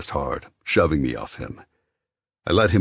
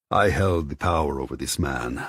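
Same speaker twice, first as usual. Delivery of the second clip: ordinary talk, nothing marked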